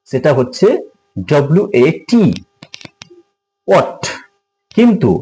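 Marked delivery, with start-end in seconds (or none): 3.7-4.0 ওয়াট with w, h silent; correct pronunciation. Shown as a demonstration. Teacher models what for viewers, no h sound. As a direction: say ওয়াট short and higher, crisp final t. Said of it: "সঠিক pronunciation".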